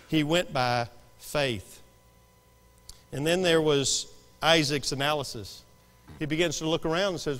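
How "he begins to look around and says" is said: ordinary talk, nothing marked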